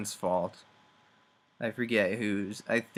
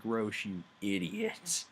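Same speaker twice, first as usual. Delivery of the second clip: mock exasperation; 4 dB quieter than usual. Exasperated aside to character.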